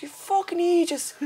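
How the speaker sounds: high-pitched comic scolding; imitating an exasperated father; mock exasperated dad. Dad scolding his daughter outside restaurant, mock exasperated.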